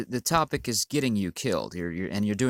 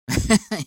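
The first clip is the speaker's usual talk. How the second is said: loud high mocking laugh; burst of laughter; 12 semitones higher, 10 dB louder than usual. Real, not acted. Vlogger laughing at the commenter's denial, loud mocking laugh. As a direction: cackle at the commenter. Laughs at his own jab.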